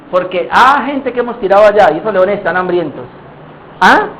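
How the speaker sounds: usual speaking voice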